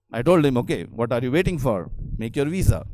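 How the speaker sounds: ordinary talk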